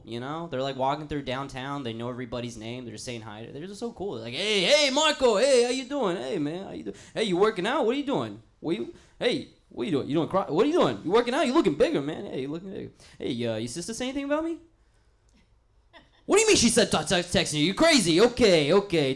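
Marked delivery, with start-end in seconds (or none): 4.3-14.6 higher, bouncy greetings; hometown boy. Acted. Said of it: "I feel like the hometown boy". 16.2-19.1 loud, higher mock disbelief; hometown boy. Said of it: "I feel like the hometown boy".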